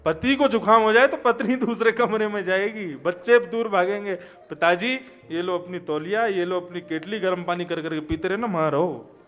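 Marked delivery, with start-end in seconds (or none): none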